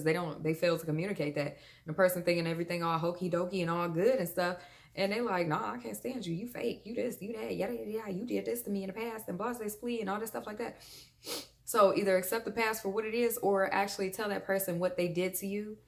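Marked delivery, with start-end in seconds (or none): 5.5-10.8 higher-pitched imitation of griping; mock grudge-holder. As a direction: rattle off mock accusations, higher and quick. Imitates person holding a grudge.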